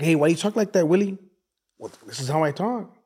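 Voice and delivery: high, loud Chicano-accented back-and-forth; animated Mexican-accented English; Mexican-American accent. Shown as a demonstration. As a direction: act out being questioned, in a Mexican neighborhood accent. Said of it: "This was my accent before I started traveling".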